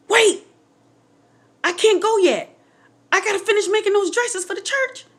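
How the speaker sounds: high, loud plea to hold on; insistent high-pitched objection; urgent protest. Retold or imitated. Acting out her own plea to stay.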